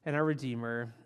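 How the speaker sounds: hushed, reverent opening prayer. Acted. Opening prayer before sermon.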